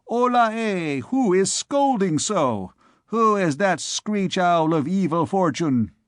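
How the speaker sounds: high-pitched hail easing into a taunt; jeering student. Acted. Student hailing the scolding bourgeois, jeering. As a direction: call out high, mocking the scolder.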